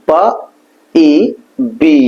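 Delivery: বা then ই, slowly stretched into বি; drawn-out B. Shown as a demonstration. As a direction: sound it out বা... ই... বি. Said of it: "টেনে একটু লম্বা করে".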